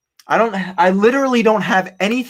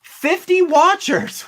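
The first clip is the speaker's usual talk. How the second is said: disbelief; 12 semitones higher, much slower than usual. Real, not acted. Exasperated about unsold item.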